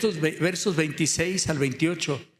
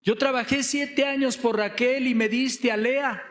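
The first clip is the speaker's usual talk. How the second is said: raised-pitch accusing protest; retold outcry; 7 semitones higher than usual. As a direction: cry out in reproach, higher but not truly shouting. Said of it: "le empieza a gritar".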